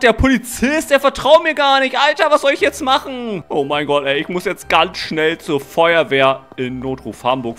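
ordinary talk, nothing marked

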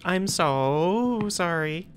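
drawn-out slow apology; slow sorry. Real, not acted. Gamer drawing out a slow apology to the monster. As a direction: stretch "so" out, very slowly.

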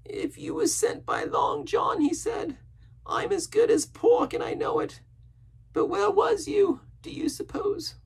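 very high, slow, partly whispered; frightened suspicious castaway. A narrator's reading. Castaway fearing Jim was sent by Silver, anxious. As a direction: press it anxiously, high and drawn out.

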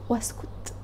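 breathy whispered aside. Real, not acted. Teacher whispering an aside to the students.

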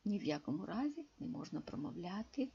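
usual speaking voice

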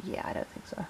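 very quiet mock reluctance for a pet; barely voiced, an unconvinced aside for the pet; voiced reluctant guinea pig. Owner voices guinea pig declining the car's encouragement, hushed mock-reluctance. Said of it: "she's just like".

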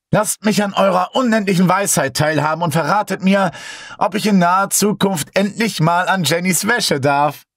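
viel höher, pathetisch feierlich; gespielte Beschwörung. A narrator's reading. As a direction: wie ein überdrehter Magier flehen. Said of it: "weiter seine Beschwörung sprach". (Ben fleht die Geister viel höher und pathetisch an)

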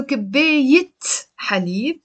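كباية ending in a crisp -it before حليب; teacher's linking T, then حليب; T pronounced at the join. Shown as a demonstration. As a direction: sound a T at the end of كباية. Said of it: "you pronounce it".

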